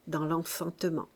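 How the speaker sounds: ordinary delivery, nothing marked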